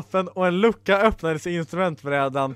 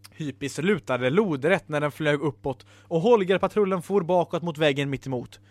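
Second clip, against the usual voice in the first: plain reading voice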